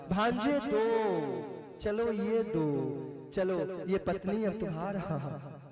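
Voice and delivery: lightly voiced scheming uncle, slow and low; sly Shakuni. Shakuni taunts his nephew while throwing dice, lightly sly. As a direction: gloat softly over each throw.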